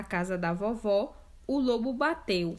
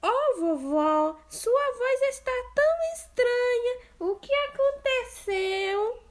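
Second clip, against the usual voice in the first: put-on much higher, slow wondering girl; puzzled girl; 5 dB louder than usual. Girl noticing grandma's strange voice.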